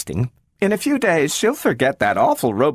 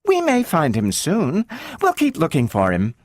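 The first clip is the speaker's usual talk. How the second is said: higher soothing mom, a touch strained; mother distracting daughter with the dog; consoling mother; 8 semitones higher than usual. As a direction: comfort while hiding the truth.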